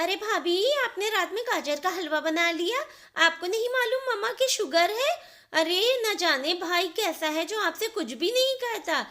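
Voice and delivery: high, loud, sharp telling-off of the sister-in-law; sister-in-law angrily scolding her brother's wife on the phone; angry scolding. Acted. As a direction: scold her hard over the phone, pitch up.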